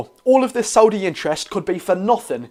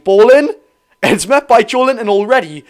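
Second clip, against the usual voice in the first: excited call; 6 semitones higher, 7 dB louder than usual. Real, not acted. Live play-by-play cross.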